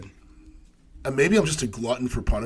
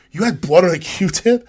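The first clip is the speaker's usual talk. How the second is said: higher, louder incredulous question; doctor shocked the patient waited; alarmed doctor; 5 semitones higher, 5 dB louder than usual. Retold or imitated. Doctor questions patient about bloody Q-tip, alarmed and incredulous.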